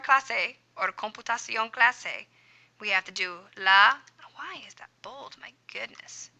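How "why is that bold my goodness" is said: whispered annoyed aside; quiet exasperated whisper at the screen. Real, not acted. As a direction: breathe it out to yourself, puzzled. Aside at a formatting glitch.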